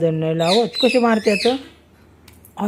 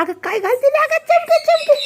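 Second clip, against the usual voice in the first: surprised exclaim. Real, not acted. Mother startled by the girl with a stone, high exclaim.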